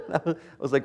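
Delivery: ordinary talk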